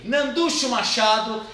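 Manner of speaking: ordinary talk, nothing marked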